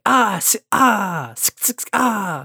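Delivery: high, strained battle yells; Tommy fight cries. Retold or imitated. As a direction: mimic the hero's grunting. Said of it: "Tommy's cadence".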